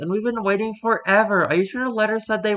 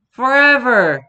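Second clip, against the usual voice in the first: higher and louder, an exuberant exclamation; big eager promise; 6 semitones higher, 9 dB louder, slower than usual. Acted. Friend answers the lost girl, loud and eager. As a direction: shout it back with glee.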